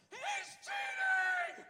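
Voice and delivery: put-on piercing high-pitched child's tantrum yell; top of his lungs. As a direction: imitate a little boy screaming in outrage. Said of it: "Just at the top of his lungs".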